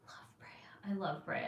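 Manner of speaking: soft, unvoiced "Love Brea"; whispered aside. Real, not acted. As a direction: whisper it fondly to yourself. Fond aside about her hometown.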